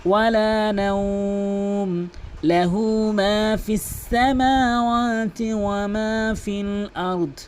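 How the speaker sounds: slow, melodic chanted recitation; Quran recitation. Shown as a demonstration. Teacher recites for students, slow melodic chant. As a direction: chant the verse slowly with long held vowels.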